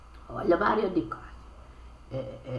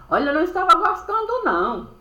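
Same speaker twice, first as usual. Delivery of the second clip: high, loud, fast indignant rush; 9 semitones higher, 5 dB louder, faster than usual. Acted. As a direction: blurt it out, fed up. Indignant outburst while retelling.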